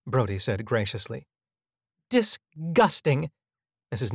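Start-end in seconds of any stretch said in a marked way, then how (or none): 2.1-3.3 slow, higher disgusted drawl; prim lady's slow sneer. A narrator's reading. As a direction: drag out the word with contempt. Mrs. Knight sneers at the boys.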